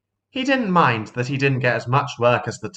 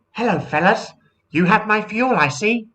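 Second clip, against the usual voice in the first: higher, friendly lady plane; seaplane voice; 11 semitones higher than usual. Acted. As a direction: greet the boys sweetly. Sally Seaplane speaks.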